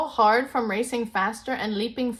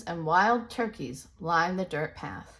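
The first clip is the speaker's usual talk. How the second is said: plain reading voice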